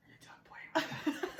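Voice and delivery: mock-creepy whisper with amusement; playful sinister whisper. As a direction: whisper it like a creepy child giving orders. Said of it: "If they whisper".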